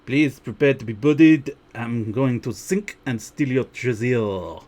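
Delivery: put-on comic accent from 'Allo 'Allo, drawn out. Threatening to sink a ship and steal treasure, hammed-up 'Allo 'Allo accent. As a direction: menace them slowly in an 'Allo 'Allo accent. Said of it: "I love how you knew the accent just by me adding Z's".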